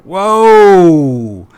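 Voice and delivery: amazed whoa. Real, not acted. Host reacting to the crazy scene, a loud amazed whoa. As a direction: let the wonder burst out.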